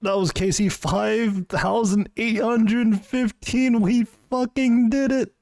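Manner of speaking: high-pitched ecstatic shriek; shrieking jackpot winner. Real, not acted. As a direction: scream with disbelieving joy. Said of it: "that was my genuine reaction".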